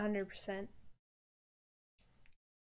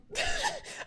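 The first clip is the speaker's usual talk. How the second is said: high cry-laugh; high sobbing laugh. Real, not acted. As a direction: break into a tearful laugh. Upset streamer laughs crying.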